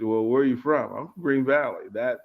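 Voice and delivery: ordinary talk, nothing marked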